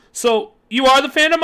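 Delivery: high loud sudden blurt. Acted. Kid confronting the stranger, sudden high blurt. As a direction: blurt the question out. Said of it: "I blurted out".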